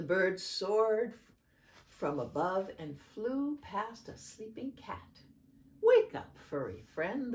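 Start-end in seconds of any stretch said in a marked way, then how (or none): 5.8-7.3 chirped. A narrator's reading. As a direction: pipe it like a little bird. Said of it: "the bird chirped".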